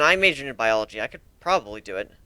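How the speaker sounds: usual speaking voice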